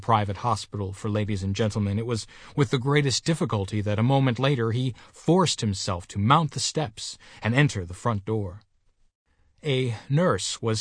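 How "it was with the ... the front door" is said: usual speaking voice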